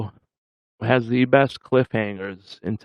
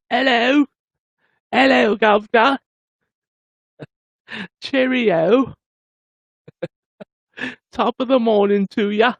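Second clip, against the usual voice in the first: high-pitched, hammed-up English greetings; mock English accent; 11 semitones higher, 3 dB louder than usual. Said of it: "know it's English".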